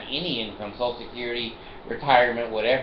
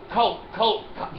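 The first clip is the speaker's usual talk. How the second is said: raised, higher mock chant of cult; mock yell; 7 semitones higher than usual. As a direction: call cult out the sunroof, a bit higher. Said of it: "and then I yell".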